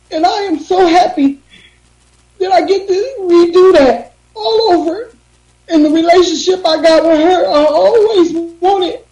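pushing words out through crying; speaking through tears about her mother. Real, not acted. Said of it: "I didn't mean to cry".

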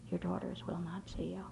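breathy whisper of bad news. Dean telling a mother her daughters refuse her, whispered. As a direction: repeat it under your breath, gently.

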